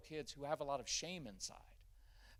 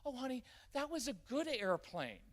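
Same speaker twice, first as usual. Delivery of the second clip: syrupy mock praise; 10 semitones higher, 3 dB louder than usual. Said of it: "parent's dismissive soothing voice".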